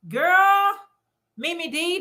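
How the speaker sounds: high, loud delighted call; light up as she greets her viewer; excited greeting. Real, not acted. Host greets a chat viewer, with delight.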